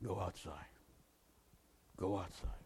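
low, hushed voice of God giving direction; divine voice. Retold or imitated. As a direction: speak as a quiet voice from above. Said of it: "The voice said to me distinctly".

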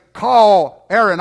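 slow, loud command to a machine. Retold or imitated. Preacher giving his car's phone system a command, slow and loud. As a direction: say it slowly and firmly for the car.

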